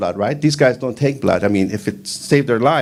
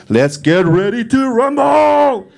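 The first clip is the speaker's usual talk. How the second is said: drawn-out, booming higher announcer call; ring announcer; 10 semitones higher, 4 dB louder, much slower than usual. Retold or imitated. Imitating a boxing ring announcer calling out the big fight. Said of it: "that guy, you know, that would go".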